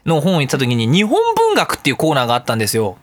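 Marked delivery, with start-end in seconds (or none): none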